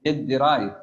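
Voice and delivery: "derive" loud and clear with both syllables; correcting loudly. Shown as a demonstration. Teacher tells the student the word is loudly "derive". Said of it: "It drive?".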